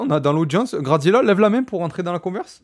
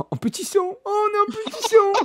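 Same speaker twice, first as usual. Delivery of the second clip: much higher, squeaky, amused echo; mock high squeal. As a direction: squeak the words up high in fun. Host echoes caller's phrase mockingly.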